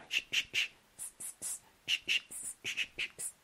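isolated voiceless hushing sh and hissing s; drilling sh against s. Shown as a demonstration. Teacher drills sh against s, carefully differentiated. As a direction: keep sh and s clearly apart. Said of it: "diferenciarlos bien".

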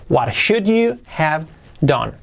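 "What should you have done?" slowed and spaced out; slow word-by-word model. Shown as a demonstration. Teacher repeats the question for learners, slowly, word by word. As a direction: separate the words for learners.

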